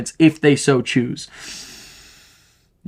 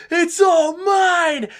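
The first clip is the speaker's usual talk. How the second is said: very high, slow, drawn-out gloating cry; greedy triumph; 14 semitones higher, much slower than usual. Retold or imitated. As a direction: savour the power as it overtakes him. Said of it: "He's like".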